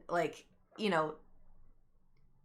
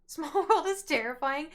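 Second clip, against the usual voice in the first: laughing; 9 semitones higher, 3 dB louder than usual. Real, not acted. Laughing remark.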